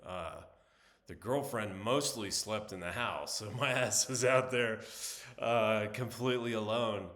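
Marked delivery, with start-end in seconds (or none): 3.4-5.9 louder, laughing through the words. Real, not acted. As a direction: laugh while saying it. Laughing at own tent story.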